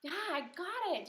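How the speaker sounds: high, pretend eureka; teacher pretending to have the idea; mock light-bulb moment. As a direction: play at just getting it. Acts having the answer.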